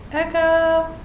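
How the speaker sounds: testing the room's echo; hallway echo. Real, not acted. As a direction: call out to hear it bounce. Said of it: "probably it is echoey".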